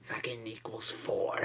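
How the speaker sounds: hushed punchline whisper; mock math answer whispered as a punchline. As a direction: whisper the payoff.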